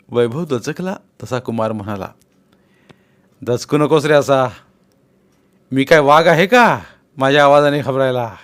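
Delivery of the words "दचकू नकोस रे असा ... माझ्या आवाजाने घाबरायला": louder, bluff but friendly; Kumar reassuring. A narrator's reading. Kumar calms startled Vaibhav, loud and friendly. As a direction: laugh off his friend's fright.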